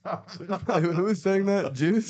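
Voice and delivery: surprised. Real, not acted. Laughing disbelief reply.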